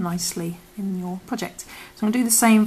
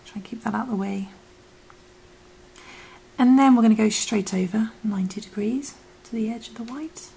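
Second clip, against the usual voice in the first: whispering; 4 dB louder than usual. Acted. Whispered craft tutorial.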